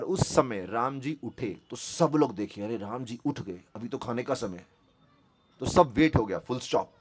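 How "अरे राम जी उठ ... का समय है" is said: low, soft murmur of puzzlement; hushed murmur. Retold or imitated. Diners seeing Ram get up before the meal, hushed murmur. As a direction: say it under your breath, low and hushed.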